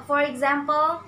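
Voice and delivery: ordinary talk, nothing marked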